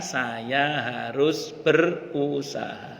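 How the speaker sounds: very slow, syllable by syllable; deliberate and drawn out, every word weighed; slow resolve. Retold or imitated. Preacher drives home Hajar's creed, slow resolve.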